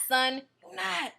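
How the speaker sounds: ordinary talk, nothing marked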